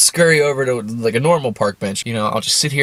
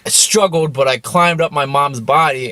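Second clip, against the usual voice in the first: ordinary talk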